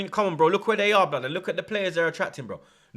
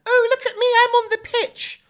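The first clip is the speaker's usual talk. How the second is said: mock a self-important pundit way up high; 6 dB louder than usual. Mocking attention-seeking pundits.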